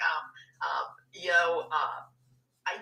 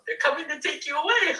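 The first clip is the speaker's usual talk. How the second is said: movie line quote; 5 semitones higher, 5 dB louder than usual. Pretend. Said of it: "then I wanna go".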